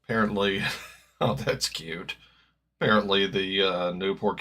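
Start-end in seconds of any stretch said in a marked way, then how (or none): none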